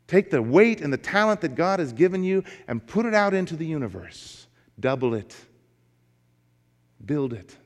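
rising, urgent preacher's call; fervent sermon call to action; preaching exhortation. Acted. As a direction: urge the congregation to take the risk. Sermon exhortation at full force.

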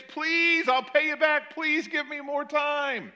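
high, slow desperate pleading; begging servant. Acted. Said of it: "but he begs".

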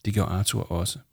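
ordinary delivery

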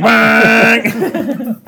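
loud, high holler through laughter; joke-shout it out of nowhere; mock sudden outburst. Acts out sudden outburst in car.

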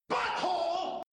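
high squeaky shouted Butthole; silly catchphrase. Pretend. Podcast host yells the running gag in a squeaky voice. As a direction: blurt it in a squeaky cartoon voice.

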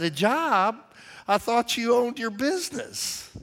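high, incredulous questioning; surprised. Retold or imitated. Pastor to tavern owner asking for work, surprised. Retells surprise at job request.

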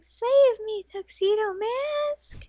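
high, drawn-out mock plea; hammed-up helpless heroine calling for rescue; damsel in distress. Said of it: "when she was about to pass out".

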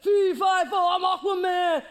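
loud, slow, high booming taunt; hammed-up giant yell; giant's taunt. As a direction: roar it across the valley.

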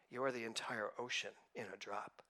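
hushed whispered revelation. Acted. Minister to congregation, whispering a sacred revelation. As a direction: breathe it like a secret.

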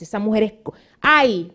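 ordinary delivery

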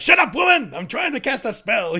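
high, loud mock yell, laughing at the end; mock shout. Miracle Max yelling at his nagging wife, in a high mock shout. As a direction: bark it like a hammed-up cranky old wizard.

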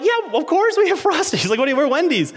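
very high, bubbly imitation of a drive-through worker; exaggeratedly perky retold reply; chirpy cashier. Imitating the unfailingly happy Wendy's worker.

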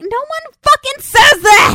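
high, loud, slow exasperated rant; exasperated outburst. Real, not acted. Host ranting at the show, loud slow exasperation. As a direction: blast it out in disbelief, high and slow.

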